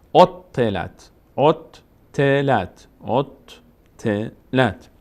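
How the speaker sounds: اوتلت very slow, t held double; slow doubled t. Shown as a demonstration. Teacher models اوتلت slowly with doubled t.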